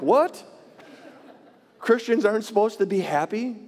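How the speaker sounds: mock surprise. Pastor answering the gym guy, mock incredulous. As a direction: act stunned at the idea. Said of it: "I'm like".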